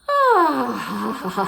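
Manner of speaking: loud, high rugged sighing laugh; a damsel's sigh, hammed-up; loud high comic princess sigh. Shown as a demonstration. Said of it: "princess sighing laughter".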